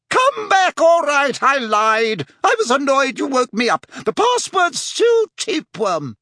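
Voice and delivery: high, loud fat-lady holler; calling after him. Acted. Fat lady to Harry sprinting off, calling out. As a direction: call him back in a high, urgent lady's voice. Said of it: "who was calling after him".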